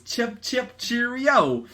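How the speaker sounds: high, chirpy cheerio; hammed-up jolly friend; mock British cheer. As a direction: toot it like a posh Brit. Friend's bubble in comic.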